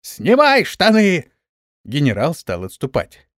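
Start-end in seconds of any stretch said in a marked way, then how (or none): 0.0-1.3 high, loud, drawn-out crowd cry; indignant yell. A narrator's reading. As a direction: yell it slowly in outrage. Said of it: "возмущённо завопили". (возмущённый протяжный вопль)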